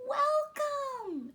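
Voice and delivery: very high, loud, joyful greeting; welcoming moon. A narrator's reading. The moon greeting the astronauts, high and joyful. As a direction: sing out the welcome. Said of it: "she greeted the men".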